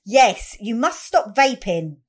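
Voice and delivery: normal talking voice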